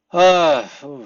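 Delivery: a loud, high ooh of surprise; surprised ooh. Shown as a demonstration. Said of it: "showing surprise".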